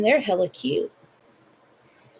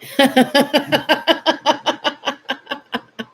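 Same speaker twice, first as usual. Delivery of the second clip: long high bubbling laugh; real laugh; 7 semitones higher, 4 dB louder than usual. Real, not acted. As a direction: let out a big real laugh. Laughs at chat.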